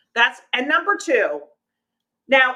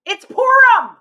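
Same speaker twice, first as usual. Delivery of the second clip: high, loud comic cry; mock outburst; 9 semitones higher, 5 dB louder, slower than usual. Mock outburst over the longest show.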